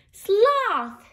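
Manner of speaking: high, loud name call; storybook crowd rounding on the culprit; accusing shout. Acted. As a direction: shout the name in mock blame. Animals all turn on Sloth.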